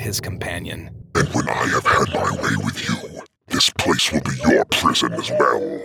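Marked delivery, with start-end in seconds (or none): 1.1-5.8 very low monster voice; deep demonic threat. A narrator's reading. Compulsion threatens Greatheart, deep and menacing. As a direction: menace him like a dark fiend.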